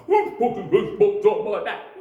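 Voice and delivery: high, loud indignant bellow; angry hippo shout. Acted. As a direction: yell it as a furious hippo.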